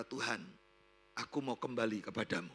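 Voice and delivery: low, soft return to God; subdued repentant prayer. Retold or imitated. Repentant woman tells God she wants to return, subdued low prayer. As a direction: pray it quietly, humbly. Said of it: "Dan dia berkata".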